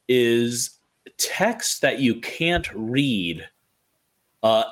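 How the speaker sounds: usual speaking voice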